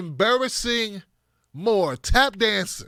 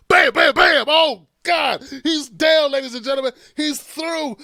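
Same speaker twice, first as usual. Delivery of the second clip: shouting ringside call, louder and higher; fight announcer shout; 5 semitones higher, 6 dB louder than usual. Pretend. Comic calling Bernie knocking Joe down, excited shout. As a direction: yell it like the knockout just landed.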